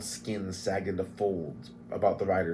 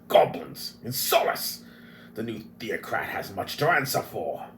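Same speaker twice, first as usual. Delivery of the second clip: hissed angry outburst; outraged dwarf; 3 semitones higher than usual. Acted. Flint raging at the theocrat, hissed. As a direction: spit it out in disgust.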